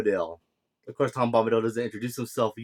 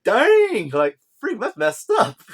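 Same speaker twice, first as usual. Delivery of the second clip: much higher and louder, scandalised outburst; shocked reaction; 10 semitones higher, 6 dB louder, slower than usual. Retold or imitated. Reviewer reacting to the mean nickname, loud shocked outburst. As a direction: react like you just saw someone bullied. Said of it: "I was like".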